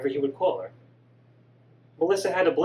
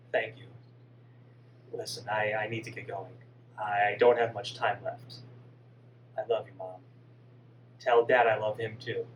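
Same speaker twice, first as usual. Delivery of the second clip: put-on lower, softer pleading boy; gentle dead son; 7 semitones lower, 4 dB quieter than usual. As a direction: hush her tears softly. Son says goodbye.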